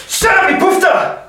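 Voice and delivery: high, loud outburst; sudden shout. Retold or imitated. Tram passenger finally snapping, shouting at the ranter. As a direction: burst out yelling at the ranter. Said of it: "finally he just bursts and just goes".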